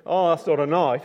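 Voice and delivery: Aussie, scoffing at the little blade; Australian accent. Retold or imitated. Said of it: "that's how they speak in Australia".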